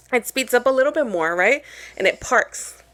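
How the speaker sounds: ordinary talk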